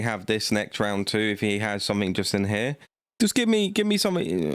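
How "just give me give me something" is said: pleading cry. Real, not acted. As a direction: beg the deck for a good card. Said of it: "begging the cards".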